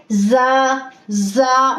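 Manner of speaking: जा with a hissing whistle on the ज़; whistling za. Shown as a demonstration. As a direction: let the ज़ in जा whistle. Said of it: "थोड़ा सा whistle sound भी साथ आ रहा है". (जा में सीटी की आवाज़)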